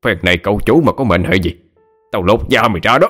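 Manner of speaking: master berating a servant, restrained volume; acted, contained fury; angry scolding. A narrator's reading. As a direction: curse and threaten the maid without shouting. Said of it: "quay sang trút giận lên cô người ở".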